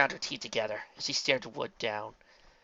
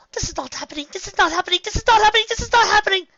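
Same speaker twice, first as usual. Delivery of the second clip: panic building; 11 dB louder, faster than usual. A narrator's reading.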